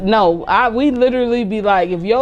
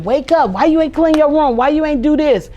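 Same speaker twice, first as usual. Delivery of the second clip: nagging parent; 7 semitones higher, 3 dB louder than usual. Retold or imitated. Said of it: "woke them up with all this negativity".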